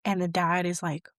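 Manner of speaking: ordinary talk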